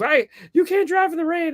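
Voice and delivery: very high-pitched imitation of panic; retold freak-out, voice shot way up; mock freaking out. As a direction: imitate a frantic passenger squealing at the driver. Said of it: "she's freaking out".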